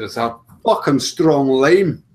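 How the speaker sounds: put-on slow, loud Scottish accent. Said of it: "That was actually quite a good impression".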